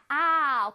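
"아우" as a long open ah gliding to oo; ow sound. Shown as a demonstration. Teacher shows the sound of ow, open glide. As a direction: stretch "아우" like the English ow. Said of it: "아우 소리가 나요".

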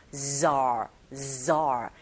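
"zar" with a buzzing bee-like z. Shown as a demonstration. 벌 소리처럼 z를 울려서. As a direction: let the z buzz like a bee. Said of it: "벌이 날아갈 때 들리는 소리처럼".

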